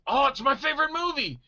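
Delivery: high-pitched, hammed-up fan excitement; mock thrilled fan. Fan at the counter recognizing an actor, thrilled. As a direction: gush it like a starstruck fan.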